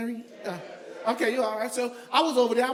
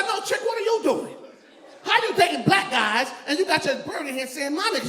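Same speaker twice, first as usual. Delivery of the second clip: indignant; 6 semitones higher than usual. Retold or imitated. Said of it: "I said".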